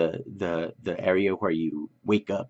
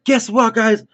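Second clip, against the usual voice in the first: much higher, louder, strained hurried doctor apologizing; a hammed-up flustered surgeon calling out to the room; surgeon's apologetic voice; 9 semitones higher, 6 dB louder than usual. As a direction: play the rushed surgeon breaking bad news, pitched way up. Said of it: "and he's like".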